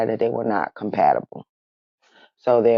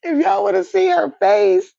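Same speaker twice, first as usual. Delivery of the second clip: high, loud laughing words; 4 dB louder than usual. Real, not acted. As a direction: laugh through it. Laughing at the story.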